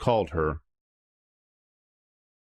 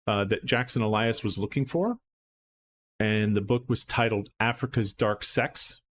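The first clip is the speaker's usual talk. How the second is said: ordinary delivery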